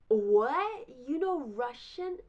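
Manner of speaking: loud, high "what?" of astonishment; shocked disbelief. Retold or imitated. To the boy who knows Russian, shocked disbelief. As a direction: blurt it out, hammed-up shock.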